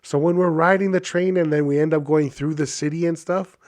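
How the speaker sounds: ordinary delivery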